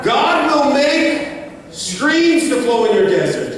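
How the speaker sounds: rising preacher's cadence building to a shout; full-force preaching. Acted. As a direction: drive it home to the congregation.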